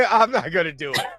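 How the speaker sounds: ordinary talk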